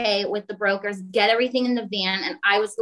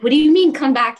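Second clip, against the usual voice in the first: loud, high shocked question; shocked disbelief; 5 semitones higher, 8 dB louder than usual. Retold or imitated. To the factory owner, a loud shocked question. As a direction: blurt it out in disbelief.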